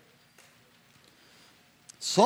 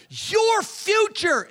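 much higher, very slow emphatic plea; urgent appeal; 13 semitones higher, much slower than usual. Retold or imitated. Saul pressing his son about his future, urgent. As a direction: stretch it out, pressing hard.

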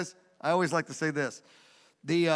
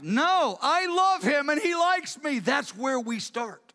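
high, insistent declaration; impassioned rebuttal; 8 semitones higher, slower than usual. Acted. David rejects the reporter's talk of probation, impassioned. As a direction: burst in and insist with fervor.

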